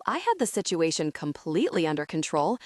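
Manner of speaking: plain reading voice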